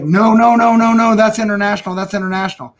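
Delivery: high, loud frantic no no no; urgent protest. Retold or imitated. To Barry in front of the locals, a frantic high protest. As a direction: cut him off before he says it.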